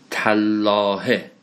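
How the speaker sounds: تالله stretched very slowly, doubled l held; deliberate Arabic recitation. Shown as a demonstration. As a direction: draw out تالله like a Quran reading. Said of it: "الان اینجا تا حرف جره".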